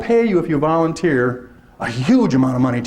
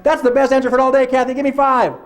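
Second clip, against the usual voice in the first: mock cheerful praise; 8 semitones higher than usual. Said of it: "It's like".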